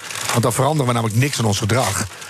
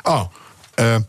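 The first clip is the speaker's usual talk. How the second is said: normal talking voice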